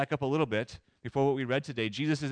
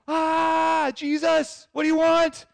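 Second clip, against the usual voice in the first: loud, high-pitched scream at Jesus; acted demoniac outburst; crazed cry; 8 dB louder, much slower than usual. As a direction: shriek it like a possessed man. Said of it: "He cried out. He exclaimed".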